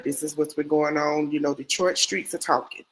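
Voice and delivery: normal talking voice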